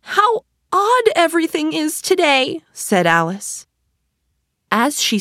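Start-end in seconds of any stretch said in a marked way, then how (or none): 0.0-2.6 put-on little girl voice. A narrator's reading. Alice voiced in a story.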